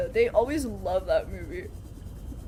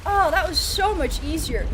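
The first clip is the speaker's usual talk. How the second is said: higher, louder relieved exclamation; pleased surprise; 6 semitones higher, 5 dB louder than usual. Real, not acted. Excited reaction in game.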